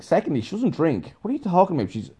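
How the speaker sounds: acting out outraged disbelief; indignant defence. Defending Sabine to Madam Q, indignant.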